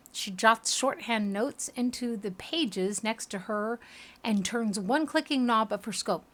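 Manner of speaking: ordinary delivery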